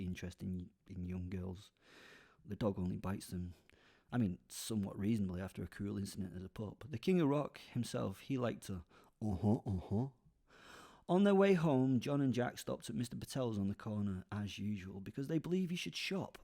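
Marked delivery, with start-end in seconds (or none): none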